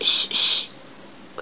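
put-on whispered shh like a mommy hushing a baby; breathy shushing whisper. Grandma shows the mommy on the bus, whispering shh. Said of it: "Why does the mommy say shh".

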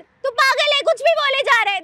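angry shout. Acted. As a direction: snap at him, furious.

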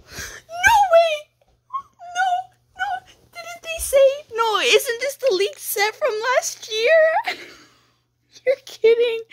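very high, squealing disbelief; excited screaming. Real, not acted. Streamer recognizes the leaked set from last year, excited screaming. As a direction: gasp and squeal, breaking into laughter. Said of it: "I'm sorry if I'm screaming".

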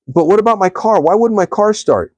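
the man whining about his car; indignant complaint. Retold or imitated. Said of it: "And he said".

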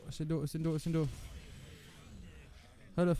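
usual speaking voice

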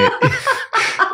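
high-pitched burst of laughter; high laughing fit. Real, not acted. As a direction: break into shrieking laughter. Laughing at imagined scene.

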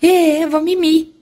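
pet voice. Pretend. Voicing the pet's reply.

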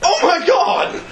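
high, laughing shocked shout; shocked cry. Retold or imitated. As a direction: cry out in horror at the wound. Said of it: "He looks over at me and goes".

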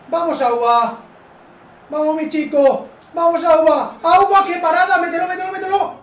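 excited cheering. Real, not acted. Gamer cheering his striker Auba toward goal, excited loud yelling.

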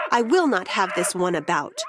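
plain reading voice

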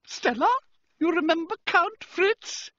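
very high, loud female voice; high-pitched delighted exclamation; gushing society lady. A narrator's reading. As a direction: greet an old acquaintance in a piercing high voice. Society lady prompts her daughter.

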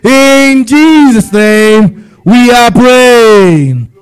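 slow, stretched closing of the prayer; slow solemn prayer ending; drawn-out closing. Acted. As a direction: draw out every word to seal it. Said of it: "drawn-out closing formula".

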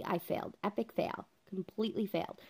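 breathy whisper of defeat; whispered admission. Real, not acted. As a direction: drop to a whisper, ashamed. Confessing the epic flirt fail.

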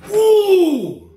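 high, loud cartoon growl of rage; Yosemite Sam. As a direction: fume like a thwarted cartoon villain. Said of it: "Like Yosemite Sam for Bugs Bunny".

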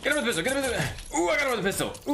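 fast, high, breathless rush; racing to land the shot; frantic excitement. Real, not acted. Frantic mid-fight chatter.

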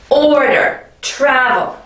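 "order, travel" with OR and TRAV punched; stress on first syllable. Shown as a demonstration. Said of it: "We care about the emphasis here".

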